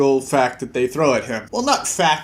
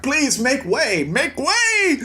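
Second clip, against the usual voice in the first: higher, urgent mock call; calling make way; 9 semitones higher, much slower than usual. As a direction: clear the crowd, hammed-up. Passengers clearing path.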